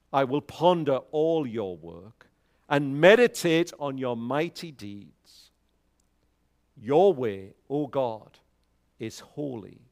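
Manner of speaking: ordinary delivery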